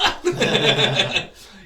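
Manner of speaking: high, loud burst of laughter; amazed laugh. Retold or imitated. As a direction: let out a high laugh of disbelief.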